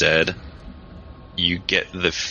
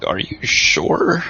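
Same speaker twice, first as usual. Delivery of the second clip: doubtful surprise; 8 semitones higher, much slower than usual. Acted. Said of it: "The second guy is gonna get a look of".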